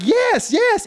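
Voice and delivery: mock excited chorus; eager agreement. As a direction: chime in like a chorus of kids. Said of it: "we all did, like".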